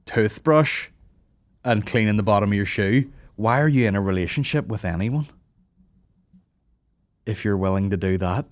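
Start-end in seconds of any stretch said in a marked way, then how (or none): none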